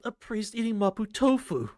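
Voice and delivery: loud, high astonishment; incredulous. Real, not acted. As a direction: marvel out loud in disbelief. Stunned reaction to the scene.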